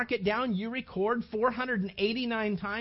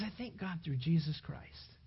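quiet relief; 4 semitones lower, 6 dB quieter than usual. Acted. Repeated quiet thanks.

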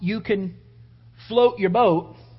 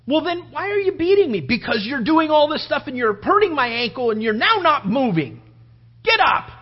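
acted quarrel; 4 semitones higher, 3 dB louder than usual. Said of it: "He just continues the argument".

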